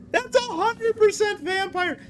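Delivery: squeaky high mock voice; silly high voice. Shown as a demonstration. Repeats line in silly voice.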